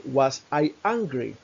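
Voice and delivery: slow, deliberate question for students; slow teacher demonstration; slow model question. Shown as a demonstration. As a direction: say each word slowly and clearly. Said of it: "por ejemplo".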